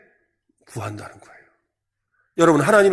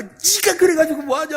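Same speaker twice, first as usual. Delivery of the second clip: scolding parent; 9 semitones higher than usual. Retold or imitated. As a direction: berate the child from up high. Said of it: "야단을 맞고". (자식을 야단치는 부모, 높은 목소리로)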